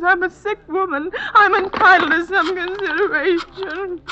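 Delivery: tearful, self-pitying complaint; whining self-pity. Acted. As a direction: plead for sympathy. Invalid demanding pity.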